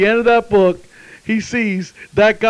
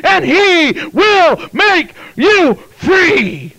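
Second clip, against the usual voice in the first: high, driving revival sermon cadence; full-force preaching; 9 semitones higher, 7 dB louder than usual. Real, not acted. Preacher urging congregation to come to Jesus, full-force preaching. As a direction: proclaim it with rising force, stretching key words.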